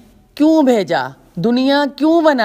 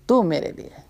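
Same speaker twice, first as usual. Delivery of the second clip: the same words repeated much softer, with تو stressed; soft echo; 2 semitones lower, 6 dB quieter than usual. Shown as a demonstration. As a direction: let تو میرے لیے fade into a soft echo. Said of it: "لیکن تو میرے لیے".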